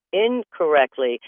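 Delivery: incorrectly drawn out slowly, each syllable clear; incorrectly spoken slowly; slow careful word. Shown as a demonstration. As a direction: stretch out incorrectly deliberately. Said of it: "when I said incorrectly".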